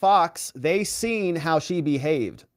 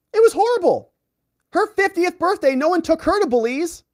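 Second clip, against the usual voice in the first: high, loud mock whining; mock whine; 5 dB louder than usual. Host mocks Fannie crying about her birthday, high loud mock whine. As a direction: whine it like a spoiled sulker.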